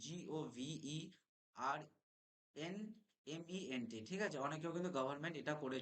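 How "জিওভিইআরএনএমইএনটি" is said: very slow, every letter pulled apart; slow spelling out. Shown as a demonstration. Teacher spelling government slowly for students. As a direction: spell out each letter deliberately. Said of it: "যেটা exactly বানান হবে".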